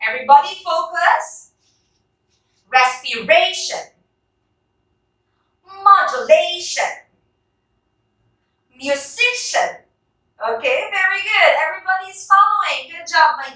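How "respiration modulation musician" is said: Respiration, Modulation, Musician, each stressed on the next-to-last syllable; stress before final syllable. Shown as a demonstration. Teacher leads rubber-band drill, next-to-last syllable stressed in Respiration, Modulation, Musician. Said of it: "try to use our rubber bands".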